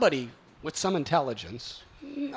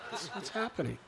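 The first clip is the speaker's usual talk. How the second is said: ordinary talk, nothing marked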